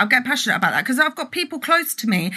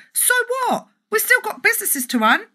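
high, indignant so what; defiant; 12 semitones higher than usual. Real, not acted. Vlogger to critical community members, defiant indignation. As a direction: dismiss the critics with scorn.

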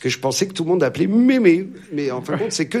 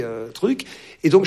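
ordinary talk, nothing marked

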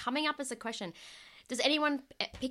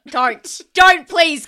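higher, loud begging to stop; loud pleading; 7 semitones higher, 19 dB louder, much slower than usual. Real, not acted. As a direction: plead loudly for them to stop. Begging friend not to continue.

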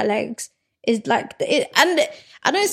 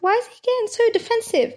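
incredulous; 11 semitones higher, faster than usual. Retold or imitated. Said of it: "I was like".

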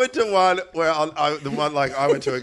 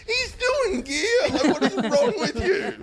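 high, laughing and slow; incredulous laugh through the words; laughing at the bloke's joke, high and slow; laughing disbelief; slower than usual. Retold or imitated. Retold group reaction.

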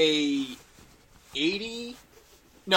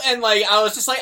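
usual speaking voice

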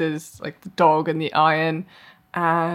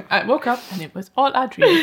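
laughing; 6 semitones higher than usual. Real, not acted. Laughing mock ending.

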